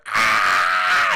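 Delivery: low, loud yell; a sudden cry from upstairs; low shouted scream; deep scream. Acted.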